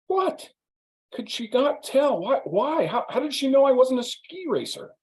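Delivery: imitating an offended friend protesting; indignant. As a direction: act wounded pride, hammed-up disbelief. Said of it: "he's very indignant".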